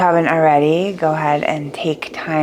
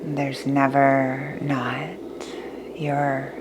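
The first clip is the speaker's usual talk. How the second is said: guided meditation; 6 dB quieter than usual. Acted.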